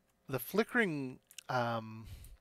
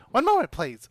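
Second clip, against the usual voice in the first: tiny excited voice; 6 semitones higher, 8 dB louder than usual. Acted. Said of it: "clearly tiny and excited".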